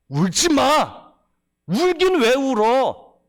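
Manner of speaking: a hint of a stern scolding, slow on the first words; mock rebuke. Preacher voicing a harsh Jesus scolding the widow, a touch stern. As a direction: scold the grieving widow, stretching out "울지 마". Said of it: "이렇게 막 책망한다면".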